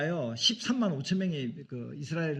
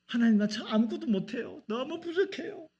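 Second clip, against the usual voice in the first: much higher, small helpless voice; humble pleading prayer; 8 semitones higher than usual. Pretend. Believer admitting weakness to God, small and pleading. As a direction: plead like a weak child to God.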